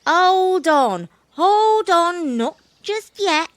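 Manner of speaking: high, loud monkey voice; stalling monkey. Acted. Monkey putting off the baby bush cat, stalling. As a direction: call a halt to an impatient kitten.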